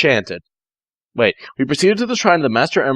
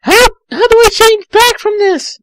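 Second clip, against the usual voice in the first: put-on much higher girlish voice, louder; high girl voice for Tails; 6 dB louder than usual. Tails panicking to Knuckles in a high girlish voice. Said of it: "I asked".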